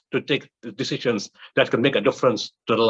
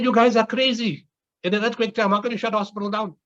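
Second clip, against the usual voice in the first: incredulous retort; 9 semitones higher than usual. Retold or imitated. Speaker scolds hospital CEO, retold.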